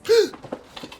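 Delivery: sudden surprised gasp; sharp gasp. Acted. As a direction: gasp in shock. Startled at fax photo.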